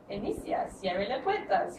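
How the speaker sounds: flat Spanish train announcement; metro announcement. Retold or imitated. Spanish metro announcement, imitated for the class. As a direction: read it like the recorded voice on the Santiago metro. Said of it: "when they say, like".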